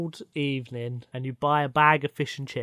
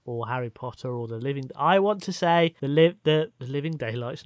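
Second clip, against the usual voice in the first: ordinary delivery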